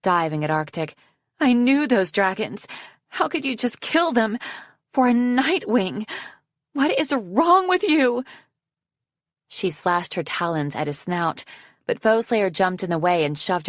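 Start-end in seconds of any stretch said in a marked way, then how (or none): 1.4-8.2 high, anguished accusation; outraged cry. A narrator's reading. Snowflake accusing Arctic of killing dragons, outraged. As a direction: demand answers, pitch rising high at the end. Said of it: "Snowflake cried".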